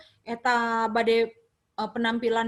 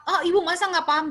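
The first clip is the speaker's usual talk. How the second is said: higher, louder, rapid scolding question; incredulous put-down; 5 semitones higher, 4 dB louder, much faster than usual. Acted. Health educator to a confused mother, incredulous put-down. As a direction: fire it back as if she should know. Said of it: "Coba kalau kita digituin".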